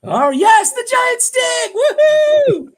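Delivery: high, loud shouting cheer; excited cheer. Real, not acted. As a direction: yell it out in delight. GM cheers the big hit.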